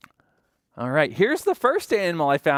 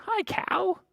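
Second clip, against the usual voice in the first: very high-pitched little hello; cutesy greeting. Pretend. Storyteller greeting the felt dog, cutesy greeting. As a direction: greet a toy in a tiny high voice. Greeting a toy animal for children.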